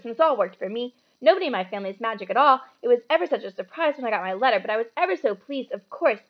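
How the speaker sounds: fast, loud bossy rattle. Acted. Hermione showing off to the boys, fast bossy rattle. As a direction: rattle it all off without a breath. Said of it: "She said all this very fast".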